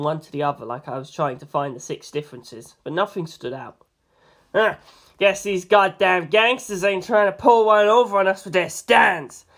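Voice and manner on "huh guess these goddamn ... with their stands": louder tough-cop voice; NYPD Blue, gruff and loud. A narrator's reading. NYPD Blue sizing up the scene, loud and gruff. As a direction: bark it like a street cop. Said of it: "said N Y P D Blue".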